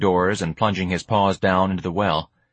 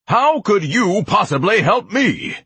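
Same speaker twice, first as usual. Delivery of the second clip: loud, slow, high-pitched indignant bellow; 12 semitones higher, 5 dB louder, slower than usual. Acted. Ignatius scorns the offer of help, bellowing. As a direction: bellow it, stretching each word. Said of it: "Ignatius bellowed".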